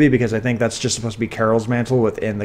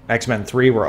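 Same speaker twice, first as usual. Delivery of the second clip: ordinary delivery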